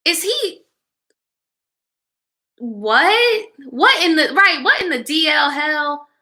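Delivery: high, rising outrage at what she just read; shocked disbelief. Real, not acted. Shocked reaction to the story.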